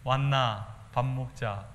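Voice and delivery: slow, drawn-out Daegu Gyeongsang dialect. Shown as a demonstration. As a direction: say "왔나 밥 먹자" slowly in the Gyeongsang lilt. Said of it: "대구 말로 하면은". (대구 사투리로 느릿하게 "왔나 밥 먹자")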